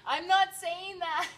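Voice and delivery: slow, high, loud and laughing; amused, drawn-out mock protest; laughing quote. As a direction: read it out through rising laughter. Laughingly reads viewer's denial aloud.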